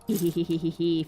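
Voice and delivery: hee-hee-hee giggle; a greedy little cartoon giggle; cartoon laugh. Real, not acted. Finds loot, giggles.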